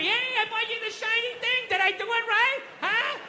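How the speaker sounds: high-pitched hammed-up pleading for approval; eager pleasing. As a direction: beg for approval in a mock high voice. Acts out desperately trying to please.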